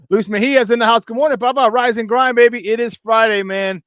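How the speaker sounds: high, loud hyped shout-out; hype greeting. Real, not acted.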